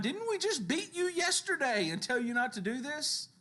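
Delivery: high-pitched, exasperated scolding; acted outraged questioning; indignant rebuke. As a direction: scold them in disbelief, pitch way up. Authorities rebuking the flogged apostles.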